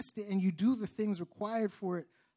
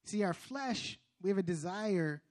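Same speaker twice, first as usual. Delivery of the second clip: usual speaking voice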